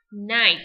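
loud clear model of "night"; teacher demo of "night"; model word. Shown as a demonstration. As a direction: say "night" crisply for the class. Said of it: "bóng đêm sẽ là night".

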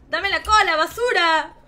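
angry demand. Real, not acted. Player wanting the tail from a rival, angry yell. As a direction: shout the demand at your rival.

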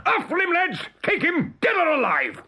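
high-pitched battle command; shouted order. A narrator's reading. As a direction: order the crew to attack. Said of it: "shouted Hook".

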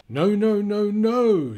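high, loud no no no; loud high lion protest; emphatic refusal. Acted. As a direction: cut them off with a loud protest. Lion objecting in story.

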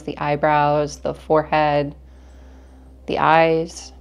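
calm, measured meditation guide with long pauses; guided meditation. Acted. Teacher leads listeners through breathing in an energy cleanse, calm guided meditation. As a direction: guide them gently through each breath, unhurried.

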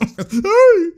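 short, very high whoop; excited whoop. Real, not acted. Commentator reacting to the play, high excited whoop. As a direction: yelp at the play.

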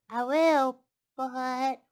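hesitant piglet. Acted. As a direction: stall as the piglet. Piglet stalls the fox.

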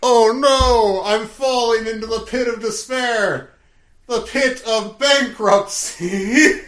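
hammed-up wail of doom, high and loud; comic cry of despair; mock despair. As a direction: ham it up like a melodrama victim falling into a pit. Mock dramatic quote, then bankruptcy joke.

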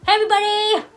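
bright, loud hello to viewers; loud cheerful greeting. Real, not acted. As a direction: call out hey everybody. Vlog greeting called out.

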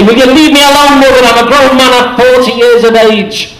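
grown son snapping at his fussing mother; mock exasperated outburst; hitting back. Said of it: "will hit back and say".

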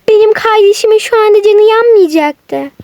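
normal talking voice

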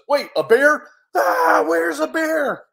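high, alarmed yelp; puzzled question jumping to a loud scream; comic panic. Acted. Bear scared of hearing about a bear, comic panic.

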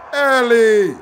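loud, high excited yells; hyped shout. Real, not acted. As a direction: scream the names in excitement. Hyped calling out names.

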